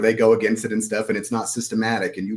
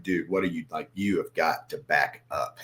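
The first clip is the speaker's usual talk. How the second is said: a hint of stern self-talk, softer and lower; talking to himself in the mirror; 4 semitones lower, 5 dB quieter than usual. Trader lecturing himself in the mirror, mildly stern. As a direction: quietly scold yourself into backing off. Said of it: "go look in the mirror for a second".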